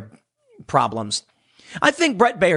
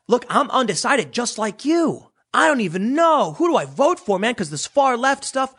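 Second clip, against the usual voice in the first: a touch of staged innocence, pitched up; mock undecided voter; 7 semitones higher than usual. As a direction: act the fake undecided voter. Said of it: "then they go".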